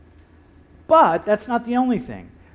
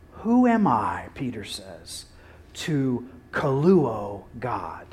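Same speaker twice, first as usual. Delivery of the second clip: slow, breathless whisper; awed whisper; 7 semitones lower, 4 dB quieter, slower than usual. Acted. Peter asking his hearers, in a slow whisper. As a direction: let the question fall to a whisper. Peter's rhetorical question, hushed.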